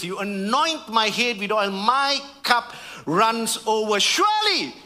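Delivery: soaring high on Surely, then sustained; climactic psalm reading. Acted. Preacher reading the psalm's ending over music, soaring. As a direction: lift Surely to the heavens.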